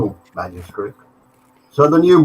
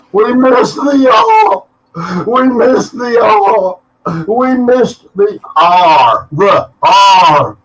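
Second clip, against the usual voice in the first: high, loud, slow anguished wail, fading down; 9 semitones higher, 7 dB louder, much slower than usual. A narrator's reading. Old abbot wailing at the wall over a lost letter, high slow anguish. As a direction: wail it out like a man in despair. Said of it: "banging his head against the wall and wailing".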